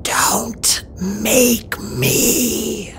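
very slow, whispery dragon voice; menacing unvoiced creature whisper; eerie rasping hiss. A narrator's reading. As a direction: draw out each word in a creaky rasp. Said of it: "She hissed again".

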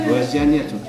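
normal talking voice

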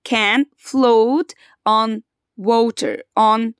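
very slow, word by word for the class; slow dictation pace. Shown as a demonstration. Teacher to grade four pupils, slowly for repeating. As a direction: spell the sentence out slowly so they can repeat. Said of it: "repeat after me".

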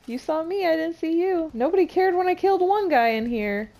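loud, high panicked yelling; startled shout. Real, not acted. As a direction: yelp in surprise. Startled by enemy, loud reaction.

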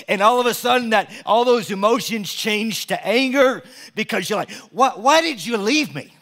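loud, high, driving sermon voice; preaching at full force. Acted. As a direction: preach it out to the whole church.

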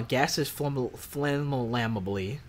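flammable stumbled over slowly as "flam-la-mably"; acted mispronunciation. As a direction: trip over flammable, adding extra "la" syllables. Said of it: "people that mispronounce".

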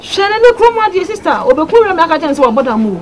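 high, loud exclamation; heated outburst. Acted. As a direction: burst out loud and high, then ease slightly. Heated exchange in the drama.

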